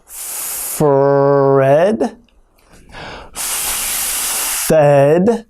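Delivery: Fred said slowly with the R stretched; slow broken-down word. Shown as a demonstration. Teacher breaking down the tongue twister slowly. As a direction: draw out Fred sound by sound. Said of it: "break the tongue twister down".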